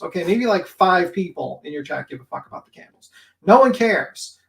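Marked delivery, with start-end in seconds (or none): 3.5-4.4 louder, punched "No one cares"; loud dismissal. Real, not acted. Streamer ranting at a rival about candles, loud and dismissive. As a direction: slam it down.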